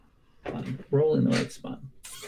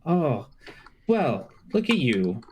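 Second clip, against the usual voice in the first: higher, taunting kobold voice, speeding up as it invites; a sneering little monster luring the heroes in; sly kobold boss; 6 semitones higher than usual. Acted. Kobold boss taunting the party from the cave, higher and mocking. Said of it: "a very familiar kobold voice saying".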